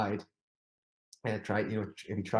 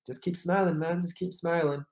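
cheerful mock encouragement; 7 semitones higher than usual. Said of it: "was just like".

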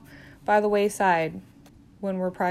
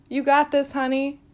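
cheering encouragement; 6 semitones higher, 5 dB louder than usual. Acted. Encouraging new grad nurse.

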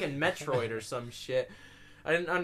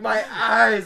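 high, loud mock agony; hammed-up cry of sympathetic pain; pained yell; 7 semitones higher, 12 dB louder than usual. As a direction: wail it as if hurt yourself. Imagines own eyes being hurt.

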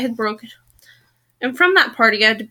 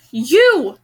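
louder, indignant exclamation, narrator's restraint; explosive outburst; 2 semitones higher, 5 dB louder than usual. A narrator's reading. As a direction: blurt it as a sudden accusation. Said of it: "he exploded".

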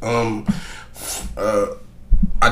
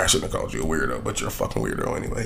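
quiet, fast, low mumbled aside; muttered fake apology; 11 semitones lower, 9 dB quieter, much faster than usual. Real, not acted. To his listeners, a quick low mock apology muttered. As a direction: take it back under your breath, then insult again.

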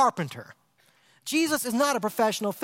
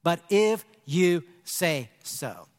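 very slow, lower and tender; slow sincere faith line; 6 semitones lower, much slower than usual. Shown as a demonstration. Preacher modelling a faith response, slow and tender. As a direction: draw each word out gently. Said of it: "the best response you can give".

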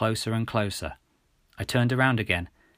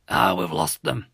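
exasperated, lower-pitched outburst; frustrated shout; 4 semitones lower, 3 dB louder than usual. A narrator's reading. Policeman watching the plane escape, frustrated outburst. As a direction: let out a low cry of frustration. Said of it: "shouted Officer Roebuck, slamming his fist".

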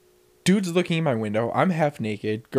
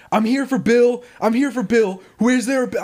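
high, loud and anxious; scared delivery guy; 10 semitones higher, 4 dB louder than usual. Retold or imitated. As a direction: nervously insist you're here for Bill. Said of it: "So he was like".

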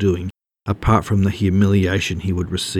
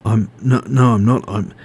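flustered denial; 2 semitones higher, 3 dB louder than usual. A narrator's reading. Ray denies spying to his workmates, flustered. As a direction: stumble over a hurried denial.

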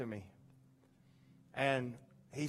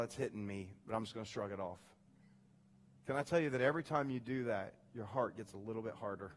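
normal talking voice

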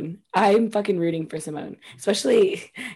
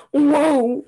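mock gargly singer's wail; hint of a gargled diva croon; 4 semitones higher than usual. Host showing a bad impression, mock gargling diva. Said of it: "Cher sounds like she's gargling water".

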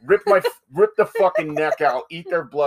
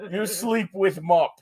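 put-on Russian accent; 6 semitones higher, slower than usual. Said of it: "we only can do Russian accent".

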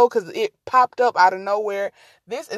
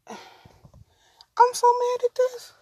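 much higher, slow and drawn out; high slow whine; 13 semitones higher, much slower than usual. Real, not acted. Frustrated with the cat, high slow whine.

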